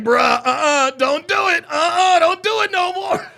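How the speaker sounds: loud, rising imitation of a friend; mock pleading warning. Acting out homie's warning.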